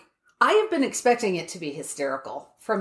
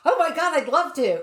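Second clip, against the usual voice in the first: eager, higher-pitched quoted reply; retold excitement; 7 semitones higher than usual. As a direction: imitate a friend delighted to be invited. Retelling friend's eager reply to invitation.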